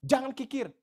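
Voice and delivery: loud, high pulpit admonition; pastor's rebuke. Acted. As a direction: call out the command like a scolding preacher. Acting out pastor's sermon rebuke. (teguran pendeta dengan suara lantang dan tinggi)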